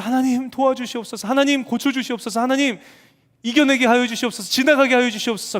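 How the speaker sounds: high, loud imitated pleading prayer; mock a believer begging God; desperate prayer. God imitating his begging prayers, loud pleading. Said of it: "그렇게 간절히 매달리고 기도하더니만".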